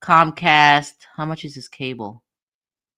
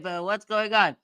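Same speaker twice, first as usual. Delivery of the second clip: ordinary talk, nothing marked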